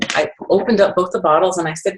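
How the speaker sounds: ordinary talk, nothing marked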